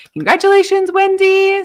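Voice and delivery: joyful shout; loud congratulations. Real, not acted. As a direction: call out congratulations to the winner. Congratulating the winner.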